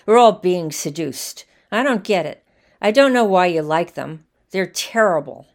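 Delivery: loud, insistent, fed-up argument; heated loud rant. Acted. Man at bar argues heatedly.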